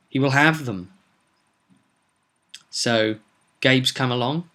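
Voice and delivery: ordinary delivery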